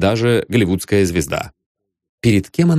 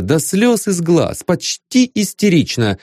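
ordinary delivery, nothing marked

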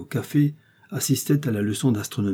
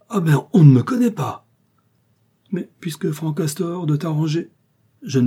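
higher and louder, distraught pleading; frantic outcry; 7 semitones higher, 5 dB louder than usual. A narrator's reading. Frantic mother-in-law protests her son-in-law's duel, loudly. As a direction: cry out, refusing to lose him. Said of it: "folle, éperdue".

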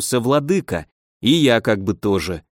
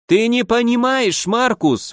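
exclaiming; 11 semitones higher, slower than usual. Acted. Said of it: "воскликнул Ганс".

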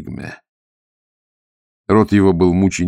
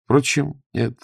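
ordinary delivery

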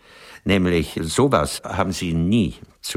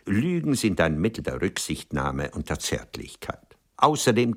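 usual speaking voice

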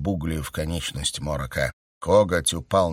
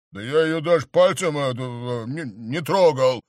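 louder, stammering protest; righteous indignation; 6 semitones higher, 4 dB louder than usual. A narrator's reading. Count protests his innocence, flustered loud indignation. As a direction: defend yourself, flustered and loud. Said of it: "праведно возмутился клыкарь".

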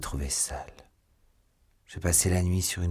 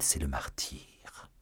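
hushed; 5 semitones lower, 9 dB quieter, slower than usual. Acted. Solemn plea defending suicide.

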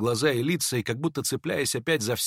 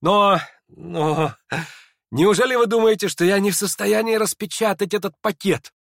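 high, loud challenge to everyone listening; indignant, high-pitched defiant question; 8 semitones higher, 6 dB louder than usual. A narrator's reading. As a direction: throw it out as a challenge. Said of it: "с каким-то вызовом".

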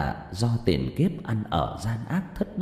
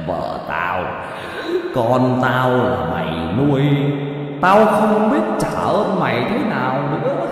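mocking ghost; 6 dB louder, slower than usual. Ghost Thụ jeering at the man who raised his family, eerie mocking voice. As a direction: taunt him like a gleeful spirit. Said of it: "vừa nói".

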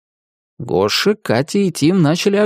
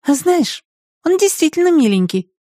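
thoughtful. Acted. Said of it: "задумчиво проговорила Катя".

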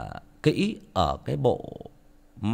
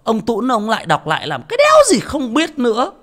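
loud, scornful listener's whine that climbs much higher; mock a fed-up listener sneering at a re-read story; scoffing grumble; 9 semitones higher, 8 dB louder than usual. MC mocks a listener who scorns re-reads, loud scornful whine. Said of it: "Các bạn đừng nghĩ rằng là".

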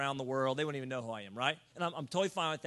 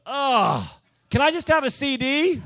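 a mock whiny ask, higher and louder; pushy fan begging the preacher for a CD, mock whiny plea; whiny lady asking; 7 semitones higher, 11 dB louder, slower than usual. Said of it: "She goes".

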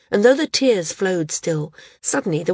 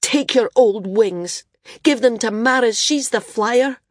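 a son rejecting his father's wings, shouting; shouted; 5 semitones higher, 5 dB louder than usual. A narrator's reading. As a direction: throw the words at him. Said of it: "he shouted".

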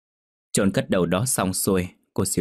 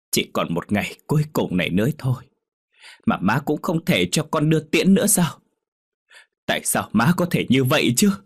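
rising to a high, louder outcry; bitter indignation; 6 semitones higher, 4 dB louder than usual. A narrator's reading. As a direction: accuse mother, building in resentment. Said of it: "giọng đầy phẫn uất". (Đạt trách má, giọng phẫn uất vút cao)

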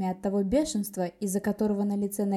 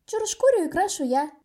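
eager; 10 semitones higher, 5 dB louder than usual. A narrator's reading. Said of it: "вызвалась Миосея".

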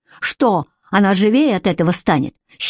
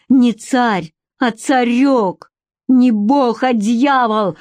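higher, insistent retort; stubbornly defiant; 4 semitones higher, much slower than usual. A narrator's reading. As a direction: hit back, stretching each correction. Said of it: "упорствовала Вика".

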